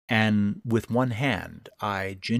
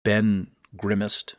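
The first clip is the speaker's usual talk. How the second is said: usual speaking voice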